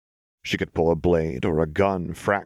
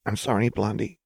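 mildly strained, higher apology; tight, muted; 4 semitones higher than usual. A narrator's reading. Jinx apologising to Lux in her arms, muted. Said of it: "Jinx's voice was tight and muted".

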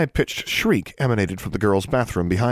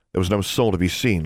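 plain reading voice

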